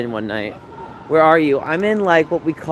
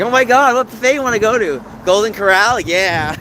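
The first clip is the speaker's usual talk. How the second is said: high, loud imitation of gushing friends; hammed-up squeal of buffet enthusiasm; mock excited; 8 semitones higher, 4 dB louder than usual. Imitating friends picking a buffet, mock excited. Acts out big girls choosing buffet.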